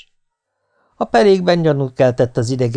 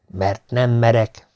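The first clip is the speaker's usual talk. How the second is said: plain reading voice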